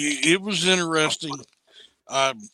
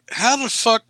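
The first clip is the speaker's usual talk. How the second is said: loud, high mock outrage; a hammed-up indignant yell; outraged Chuck; 9 semitones higher, 7 dB louder than usual. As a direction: throw the line out like an offended drinker.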